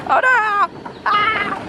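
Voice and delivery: high startled cry of dismay; surprised yelp. Real, not acted. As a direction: squeal it, caught off guard. Rain starts, sudden exclamation.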